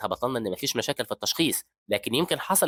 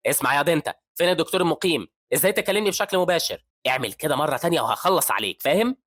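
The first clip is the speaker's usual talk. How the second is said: high, loud menacing warning; threatening scolding; 5 semitones higher, 4 dB louder than usual. A narrator's reading. Professor threatening the young doctor, loud and high. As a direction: threaten the junior from above.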